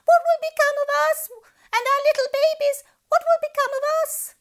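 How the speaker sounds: very high, fast panicked squeak; wailing fish. A narrator's reading. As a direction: shriek it in tiny panic.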